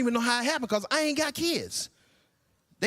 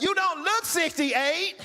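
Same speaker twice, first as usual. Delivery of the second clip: mock yelled compliment; 9 semitones higher, slower than usual. Yell he wanted.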